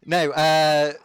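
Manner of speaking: normal talking voice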